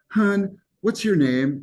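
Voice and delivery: a Southern teacher asking slow and high; Southern accent. Retold or imitated. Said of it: "very strong Southern accent".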